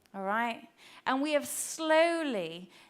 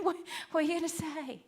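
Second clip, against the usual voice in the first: nervous flinch; 3 semitones higher, 4 dB quieter, faster than usual. Retold or imitated. Israelites flinching before God.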